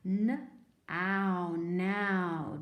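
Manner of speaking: "N", then "ow", blended into "now"; sounding out. Shown as a demonstration. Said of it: "Let's try this one".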